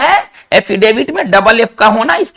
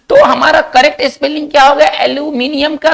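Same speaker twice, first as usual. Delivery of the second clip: ordinary talk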